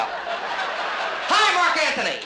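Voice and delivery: very high, loud hail; hammed-up shrieked hello; over-the-top stage greeting. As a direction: belt out the greeting far too high. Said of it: "Okay, let's go".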